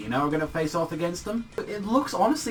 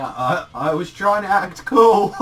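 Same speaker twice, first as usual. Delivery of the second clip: slow, louder, hammed-up embarrassed confession; sheepish admission; 4 semitones higher, 8 dB louder, slower than usual. Gargiel admitting to Levy that he was posing, sheepishly. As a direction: say it like he's caught out, scratching his head. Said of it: "him, like, scratching".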